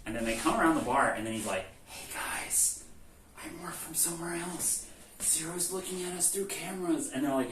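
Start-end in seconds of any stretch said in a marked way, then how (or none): 1.9-7.1 hushed secret whisper. Retold or imitated. Secret plan told behind bar.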